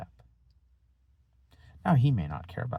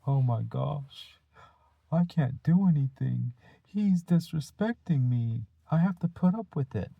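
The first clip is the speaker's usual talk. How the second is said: mock panic; 8 semitones higher, 5 dB louder than usual. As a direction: ham up the helpless teacher falling apart. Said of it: "you assume I walk away and I go".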